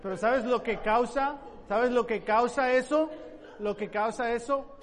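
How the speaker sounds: loud rising challenge to the congregation; emphatic repeated question. Acted. Preacher pressing the congregation with a loud rising question. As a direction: press the question harder each time. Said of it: "preacher repeats question for emphasis".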